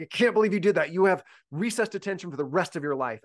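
high, loud exasperated scolding; angry teacher. Retold or imitated. Teacher snapping at a misbehaving student, loud and high. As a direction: blow up at the kid, half mock. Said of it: "being angry and mad and being like".

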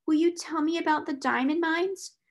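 put-on small, high little-girl question; whimpering child. A narrator's reading. Consoled child begs for a story, whimpering. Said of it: "whimpered Lottie".

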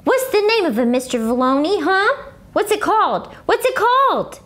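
high, insistent questions; excited kid nagging; eager pestering. Acted. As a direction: badger him for the answer. Herb pestering janitor.

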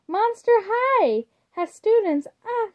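high, thrilled squeal; gleeful shriek. A narrator's reading. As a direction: shriek it with pure glee. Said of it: "she shrieked, gleeful".

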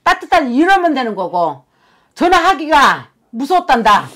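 high, loud pleading lament. Acted. Channelled sister-in-law telling the family her grief, loud and high. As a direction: pour out the dead woman's hurt at full voice. Shaman voices the dead woman's lament.

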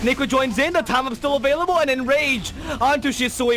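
high, loud excited casting; live play-by-play. Acted. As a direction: call every fight as it happens. Live Dota match casting.

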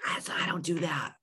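sudden whispered aside; whispered, under the breath. Real, not acted. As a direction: drop to a whisper, like a confession. Drops to aside mid-rant.

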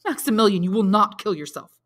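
loud, forceful prohibition; fiery command. A narrator's reading. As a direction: forbid it with blazing authority. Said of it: "his eyes flashing fire".

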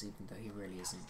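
fast, hushed whisper; whispered aside. Real, not acted. Player whispering a creepy aside about the patient. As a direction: drop to a secret whisper.

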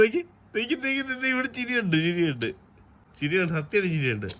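high playful teasing. Real, not acted. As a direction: tease the giggle out of him, high. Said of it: "ഇങ്ങനെ പറഞ്ഞ് ... ചിരിപ്പിക്കുക".

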